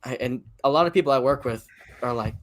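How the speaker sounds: ordinary talk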